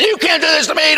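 very high mock-scream of defiance; retold scream. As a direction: shout it high, as if screaming at the devil. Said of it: "Da, da, da, screaming".

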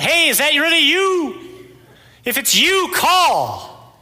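high, loud shout through a rainstorm; yelling out. Acted. Peter in a drenched boat calling out to Jesus, yelling. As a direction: holler across wind and waves, stretching the words. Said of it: "you're yelling out".

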